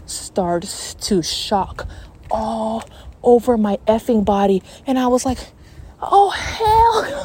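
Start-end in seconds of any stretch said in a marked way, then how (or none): 6.0-7.2 high-pitched alarmed wail, hammed up; mock scared cry. Storyteller reliving shocks through her body, high mock-scared cry. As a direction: re-enact the panic in a high cry. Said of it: "I got scared".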